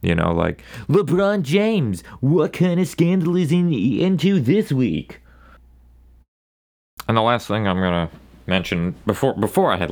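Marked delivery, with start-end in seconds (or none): 0.9-5.2 high, slow, hammed-up gossip host; mock a celebrity gossip show host; sports gossip anchor teasing a scandal; TMZ host voice. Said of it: "in their weird TMZ way".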